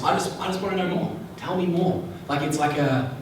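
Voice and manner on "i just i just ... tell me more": higher, inquisitive lift; curious calculator. Shown as a demonstration. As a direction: gently press to know more. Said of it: "So the calculator, on the other hand, is just".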